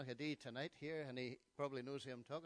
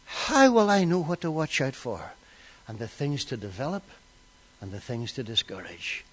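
very loud, lower pulpit voice; thundering preaching; 3 semitones lower, 25 dB louder than usual. Acted. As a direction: hammer each phrase out to the hall.

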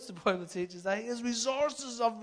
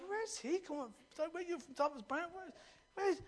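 curious Noel; 5 semitones higher, 9 dB quieter than usual. Retold or imitated. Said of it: "little guy going around".

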